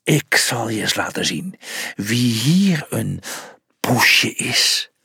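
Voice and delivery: low, slow growl; growled threat. A narrator's reading. The cat threatens the opposing team in a low growl. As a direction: let the threat rumble out low. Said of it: "gromde hij".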